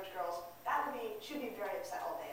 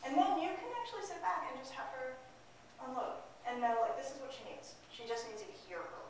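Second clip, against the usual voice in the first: usual speaking voice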